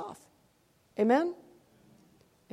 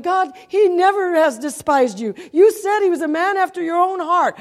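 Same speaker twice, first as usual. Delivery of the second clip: arguing; 6 semitones higher, 5 dB louder than usual. Retold or imitated. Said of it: "I got in a debate with God".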